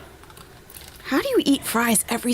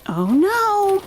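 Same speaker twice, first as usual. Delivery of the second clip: mock alarm; 11 semitones higher, 4 dB louder than usual. Real, not acted.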